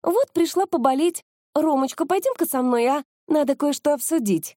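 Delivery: put-on high-pitched, coaxing girlfriend voice; sugary high voice for show; fiancée act. A narrator's reading. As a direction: coax your sweetheart away, pitched high. Fiancée act to lead him away.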